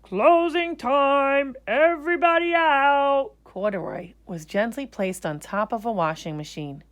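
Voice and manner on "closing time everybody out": loud, slow, higher shout; calling out. Acted. Manager calling closing time to everyone. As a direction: call across the whole shop. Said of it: "manager of the laundromat called".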